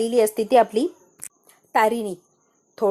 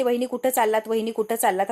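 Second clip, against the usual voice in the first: ordinary delivery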